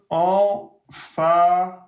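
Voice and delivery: "emphatique" slow and weighty; drawn-out stress. Shown as a demonstration. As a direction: lean hard on "emphatique". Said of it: "Je le répéterai jamais assez".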